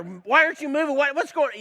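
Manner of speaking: high, loud impatient driver yelling; annoyed man shouting at a stalled car; mock angry yell. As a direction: bark it like a furious driver. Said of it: "he started to yell at her".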